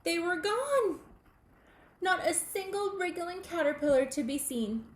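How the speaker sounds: high, loud sudden gasp of news; spring the surprise on the listener; surprised. A narrator's reading. Telling children the caterpillars vanished, high and surprised.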